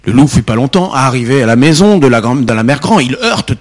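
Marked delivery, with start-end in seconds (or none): none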